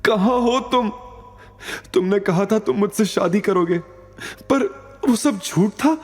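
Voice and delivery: accusing an absent lover, heartbroken; Avantika lamenting. A narrator's reading. Avantika alone, heard through door. (ऊँची आवाज़ में अवंतिका, दुखी और आहत)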